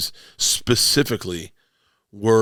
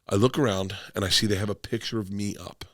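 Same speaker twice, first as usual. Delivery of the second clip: ordinary talk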